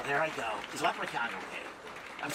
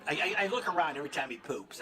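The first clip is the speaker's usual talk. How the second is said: usual speaking voice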